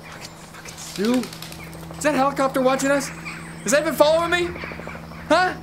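usual speaking voice

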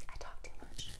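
whispered aside to herself. Real, not acted. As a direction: breathe it as a secret. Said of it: "whispered repeat".

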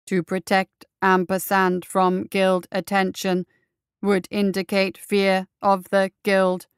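slow, loud robotic monotone; grinding monotone. Acted. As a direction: grind out every word like a machine. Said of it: "grinding out each word in a monotone".

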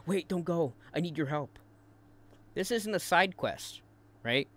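a touch of mock desperation; mock freak-out. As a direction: mockingly plead as if in a mild panic. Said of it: "you were freaking out".

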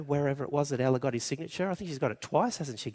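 usual speaking voice

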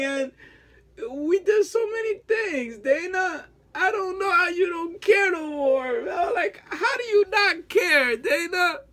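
high-pitched sobbing plea, voice cracking; crying. Real, not acted. Pleading with ex Dana to return, breaking down crying. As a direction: break down in tears while begging her back. Begging ex to come back.

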